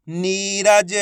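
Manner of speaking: नीरज sounded out in long syllables; drawn-out long ई. Shown as a demonstration. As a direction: stretch नी in नीरज. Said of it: "खींच के पढ़ा जाता है".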